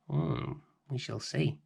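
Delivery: plain reading voice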